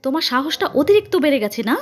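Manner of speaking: high, loud scolding; angry shout. A narrator's reading. Mother scolding Sudipa for her nerve, angry shout. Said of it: "চিৎকার করে বলল".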